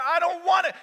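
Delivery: high, loud protest; acted pleading objection; exasperated refusal. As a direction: refuse like a cornered patient.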